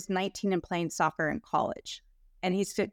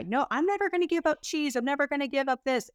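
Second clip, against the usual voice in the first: higher, mock-stubborn refusal, the second line faster; stubborn client; 7 semitones higher than usual. Italian client refusing to give up cheese, in a higher mock-stubborn voice. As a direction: play the cheese lover who won't budge. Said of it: "and he was an Italian guy".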